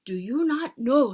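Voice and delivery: loud witch shout. Acted. Witch leader shouting at the audience, loud. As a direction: shout down at them. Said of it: "she shouted at them".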